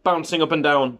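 unhurried picture-book narration with lifted key words; children's story reading. A narrator's reading. Parent reading a bedtime hare story to a child, storytelling. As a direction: read it to a little child, savouring the phrases.

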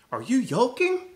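high squawk of disbelief. Acted. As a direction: squawk it at them. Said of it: "squawked Gladys".

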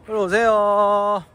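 slow coaxing call; slow drawn-out call. Real, not acted. Calling someone to come.

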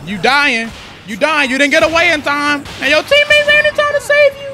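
high, loud gloating; mock the enemy as it dies; rising high, loud taunt; gleeful taunt.

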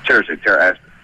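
usual speaking voice

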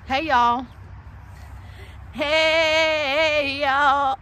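calling out hey y'all; big loud hello; loud greeting call. Acted.

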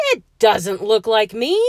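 loud storybook roar of protest. A narrator's reading. George sees his puffed-up portrait, roaring. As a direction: roar in acted outrage. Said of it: "he roared".

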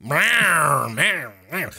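low growling rawr; monster roar. Pretend. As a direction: growl it like a beast. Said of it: "like rawr fierce".

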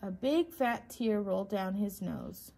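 ordinary delivery, nothing marked

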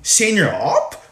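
startled. Acted. Startled question.

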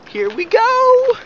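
high, loud, drawn-out rallying cry; excited shout. Real, not acted. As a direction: yell it out slowly to start the climb. Kicking off the mountain jog.

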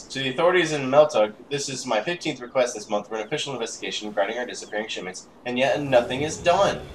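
forceful complaint read aloud; indignant letter reading. Acted. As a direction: read it like an outraged official. Reads angry in-game letter.

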